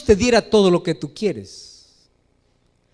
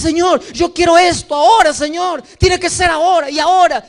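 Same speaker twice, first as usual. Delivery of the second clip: high, louder, insistent begging; impatient mock demand; 7 semitones higher, 5 dB louder than usual. The believer pesters God, impatient and loud. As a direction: demand it right now like a spoiled child. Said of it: "Y le dices".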